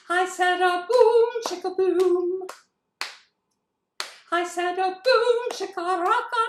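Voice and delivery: high, loud operatic singing. Shown as a demonstration. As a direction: sing it like an opera diva. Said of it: "Opera voice".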